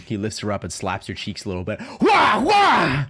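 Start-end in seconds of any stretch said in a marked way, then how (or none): 2.0-3.0 loud high wah yells; hammed-up panic yell. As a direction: yelp in cartoon panic.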